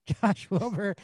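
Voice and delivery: breaking into laughter mid-name; giggling through the name; laughing. Real, not acted. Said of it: "I couldn't finish that without laughing".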